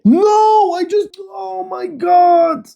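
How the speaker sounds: very high, drawn-out cry of despair; high-pitched frustrated howl; exasperated wail. Real, not acted. Setup keeps blocking him.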